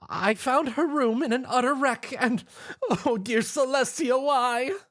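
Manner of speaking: high, tearful plea from Missus Cake; a weeping baker pleading, voice pitched high; sobbing. A narrator's reading. Missus Cake tells the table about her plight, high and tearful. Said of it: "she sobbed".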